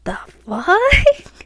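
high, loud shocked outburst; shocked disbelief. Retold or imitated. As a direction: blurt it out in stunned disbelief. Shocked reaction to cruel prank.

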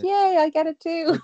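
high, loud happy cheer; excited cheer. Retold or imitated. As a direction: cheer it out with delight. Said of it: "And it's like".

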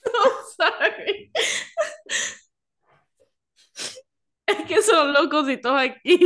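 talking through giggles; trying not to laugh; stifled giggles. Real, not acted. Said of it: "No te rías".

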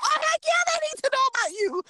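high, bubbly heck yeah, rushing the last line; excited hype friend. Retold or imitated. Friend cheering her on to strangers, happily. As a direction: gush with delight. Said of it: "She'll be like".

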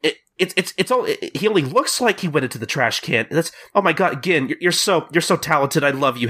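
high-pitched gushing admirer; mock adoration, pitched well up; Sachan. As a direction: imitate a smitten fangirl, tripping over words. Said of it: "Sachan is like".

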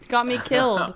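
laughing. Real, not acted. Laughing while retelling.

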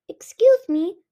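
a tiny dragonette cutting in; little piping voice. Acted. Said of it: "a little voice piped up".

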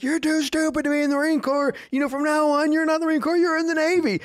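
high-pitched drill sergeant tirade; chewing out. Retold or imitated. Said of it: "chewing him out".

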